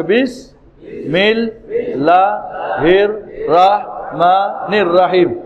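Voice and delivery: "বিসমিল্লাহির রহমানির রহিম" broken into slow separate syllables, each rising. Shown as a demonstration. Teacher has pupils say it with him, slow syllable-by-syllable drill. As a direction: lead a repeat-after-me, pausing after every syllable. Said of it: "বলেন আমার সঙ্গে".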